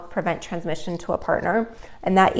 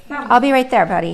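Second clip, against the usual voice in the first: calling out; 4 semitones higher, 6 dB louder than usual. Real, not acted. Calling to her son.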